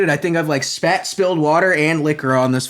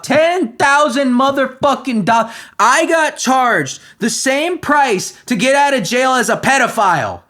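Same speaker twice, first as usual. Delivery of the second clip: furious rant; 6 semitones higher than usual. Real, not acted. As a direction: vent real outrage at the unfair bond. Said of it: "I actually am legitimately pissed".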